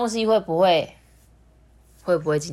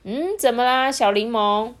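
higher-pitched questioning auntie voice; inquiring auntie; 7 semitones higher, 4 dB louder than usual. A narrator's reading. Doctor auntie answers Little Lemon's knock, inquiring. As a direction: ask a child what's wrong.

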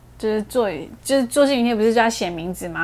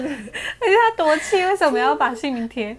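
mock indignant; 5 semitones higher than usual. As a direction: pretend to fume on her behalf. 替小孩打抱不平. (夸张地替她生气)

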